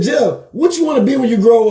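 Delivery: a lightly voiced older barber asking a kid; barber's question. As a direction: ask it like the barber in the chair. Barber Joe asks young Jeff.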